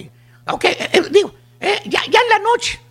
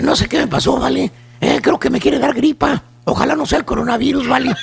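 lower, lightly mocking voice of a sick rancher; imitating the chúntaro; 6 semitones lower than usual. Host mocking the chúntaro who sleeps with the AC, lower whiny voice. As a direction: lower the voice to mock a whiny guy with a cold.

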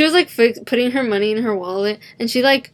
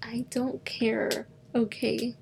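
soft, slow, fed-up muttering; muttered annoyance; 10 dB quieter, slower than usual. Retold or imitated. To a rude customer, soft fed-up muttering. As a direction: grumble it under your breath, slowly.

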